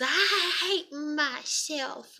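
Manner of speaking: mock wail, high and dragged out; exaggerated drawn-out whine of an upset child; child's tantrum wail. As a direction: imitate a kid's tantrum, stretched high. Imitates daughter's tantrum.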